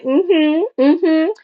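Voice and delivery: high-pitched mock confirming hum; client admitting it. Client admits to reader they smacked ex, high mock admission. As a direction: hum a yes in a small high imitation. Client's reply in reenacted exchange.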